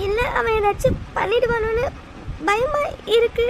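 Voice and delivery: high, sobbing voice; tearful fear. Acted. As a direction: whimper the words through tears, afraid. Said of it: "இப்படி அழுதுட்டு இருக்கீங்க". (பயந்து அழும் குரலில்)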